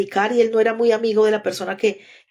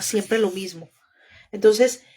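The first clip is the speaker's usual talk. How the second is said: ordinary talk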